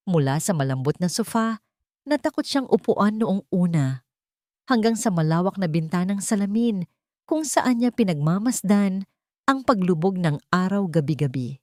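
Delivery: ordinary delivery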